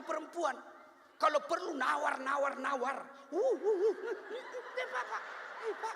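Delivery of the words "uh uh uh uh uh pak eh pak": mock haggling wife.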